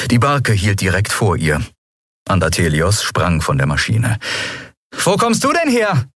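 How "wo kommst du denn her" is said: a much higher surprised call across the yard. A narrator's reading. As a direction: call out to him in glad surprise. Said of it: "rief Endriel".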